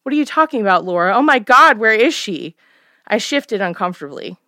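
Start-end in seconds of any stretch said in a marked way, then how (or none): 0.0-2.5 alarmed. Acted. Mother alarmed by news.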